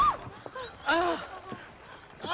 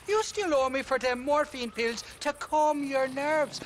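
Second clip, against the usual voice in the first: usual speaking voice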